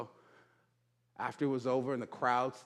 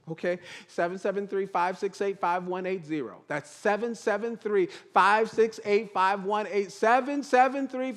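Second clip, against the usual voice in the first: fast, higher, eager recital of digits; eager rattling; 3 semitones higher than usual. Retold or imitated. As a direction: rattle off your phone number, building in excitement. Eagerly gives his number repeatedly.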